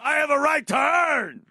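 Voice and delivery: high, loud gangster protest; mock mobster shout. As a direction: demand it like an angry mob boss. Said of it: "Tony Soprano".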